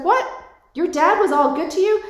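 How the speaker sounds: high, loud, incredulous What; surprised disbelief. Retold or imitated. Manager hearing the dad was calm, stunned and incredulous. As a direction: manager can't believe what she's hearing. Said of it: "Tech's manager's like".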